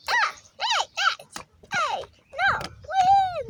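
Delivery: high-pitched playful doll voice; squeaky doll voice. Pretend. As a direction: squeak like a tiny toy. Doll ball game.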